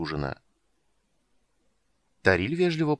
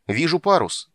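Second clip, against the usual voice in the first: higher, louder lookout's call; shouting; 8 semitones higher, 5 dB louder than usual. A narrator's reading. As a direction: call out the sighting. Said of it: "закричал Хани".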